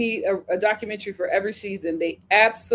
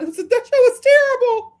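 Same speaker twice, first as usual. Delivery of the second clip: exclaiming; 12 semitones higher, 7 dB louder than usual. Real, not acted. Outraged remark.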